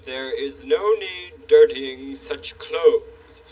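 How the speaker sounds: slow, stately royal voice; pompous king. Acted. King Friday gently scolding the handyman, slow stately royal voice. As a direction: lecture like a grand, fussy monarch.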